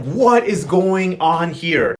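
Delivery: stretched-out, baffled question; slow confused question. Retold or imitated. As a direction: drag out "What is going on here?" very slowly. Fabiana confused at bank account.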